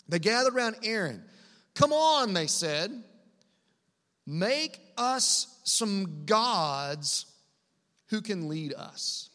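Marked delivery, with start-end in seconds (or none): 1.8-2.3 raised, louder urging call; urging crowd. Acted. Acted crowd urging Aaron. 4.3-9.3 drawn out word by word; slow, weighty demand. Acted. Quotes people's demand with weighty pauses.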